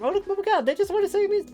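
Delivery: very high squeaky eager voice; high gushing mock naivety; mocking my younger self. Streamer mocking her eager early self in a squeaky voice.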